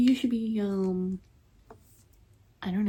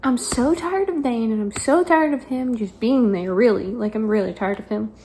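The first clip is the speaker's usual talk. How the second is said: exasperated; 7 dB louder than usual. Retold or imitated. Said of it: "But I kept saying".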